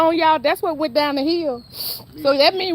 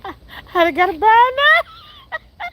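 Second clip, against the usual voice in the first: laughing quote; 6 semitones higher, slower than usual. Retold or imitated. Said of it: "You say".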